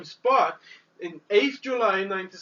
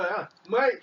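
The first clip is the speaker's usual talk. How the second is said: ordinary delivery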